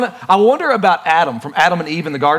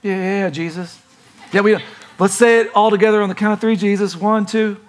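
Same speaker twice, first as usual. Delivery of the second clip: mock-eager disciples faking they understand; hammed-up play-along, a bit higher; pretending disciples; 4 semitones higher than usual. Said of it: "they start pretending a little bit".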